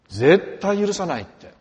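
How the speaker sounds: 「絶対」を大きく強く、ゆっくり言い切る; slow, loud mock vow of revenge on 絶対許さない; angry vow. Quoting an aggrieved neighbour. (怒りの誓いをゆっくり大声で)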